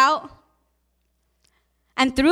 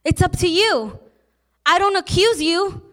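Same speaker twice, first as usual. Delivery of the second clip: louder, higher fervent preaching; rising preaching; 4 semitones higher, 4 dB louder than usual. Acted. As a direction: build to a fervent climax. Climax of sermon.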